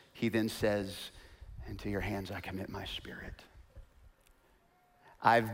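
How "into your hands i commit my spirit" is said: whispered, breathless last words; faint whispered prayer; dying whisper. Acted.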